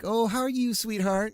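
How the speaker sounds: counter lady. Retold or imitated. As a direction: mimic a sweet lady behind the counter. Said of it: "she's being all, like".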